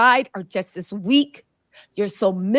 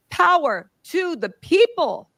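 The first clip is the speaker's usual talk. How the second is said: rallying cry; 6 semitones higher, 3 dB louder, slower than usual. Acted. Rallying chant.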